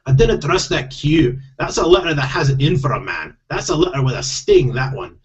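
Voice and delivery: distrustful grumble in a put-on Scottish accent; wary Scottish husband voice. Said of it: "I do have to do Scottish accents".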